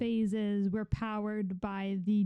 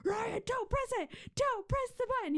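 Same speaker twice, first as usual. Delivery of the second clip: put-on high pleading yell at a kid; exasperated yell; 12 semitones higher than usual. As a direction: cry out in frustration. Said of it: "there were times where we were like".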